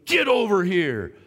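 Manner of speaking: slow, stern mock-yelled order; angry teacher. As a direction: imitate a mad teacher ordering a student over. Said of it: "he's just screaming at me".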